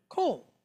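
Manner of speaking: "kol" with the vowel as O; O sound. Shown as a demonstration. Teacher showing where we get the word, O sound. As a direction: say "kol" with an O closed by L. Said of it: "makes that sound like an O".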